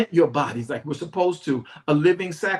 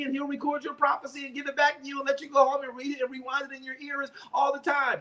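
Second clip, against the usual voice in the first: mocking excited churchgoer; 7 semitones higher, faster than usual. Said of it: "they didn't go".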